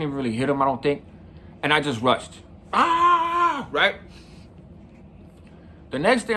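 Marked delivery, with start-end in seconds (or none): 2.7-3.7 high sustained yell; a retold war cry as you rush in; mock charging yell. Rushing at the other kid.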